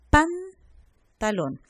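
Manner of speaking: Pantalón very slow, PAN held apart; first syllable separated. Shown as a demonstration. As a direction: say PAN, pause, then TALÓN. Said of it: "Si vemos la primera sílaba".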